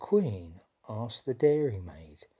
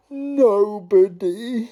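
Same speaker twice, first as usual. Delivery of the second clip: whimpering; 9 semitones higher, 11 dB louder, much slower than usual. Acted. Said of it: "he whimpered".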